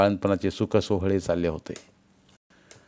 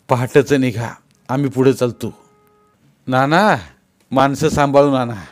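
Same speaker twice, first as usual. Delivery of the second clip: louder, raised parting call; 3 semitones higher, 6 dB louder than usual. Acted. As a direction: call back over the crowd as he leaves. Tukaba calls back while leaving. (निघताना मागे वळून मोठ्याने दिलेली सूचना)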